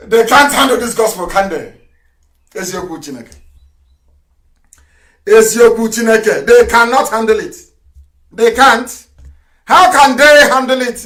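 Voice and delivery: Eziokwu loud and high, rallying; fiery broadcast preaching. Real, not acted. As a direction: thunder it at the enemies. Preaching at full force.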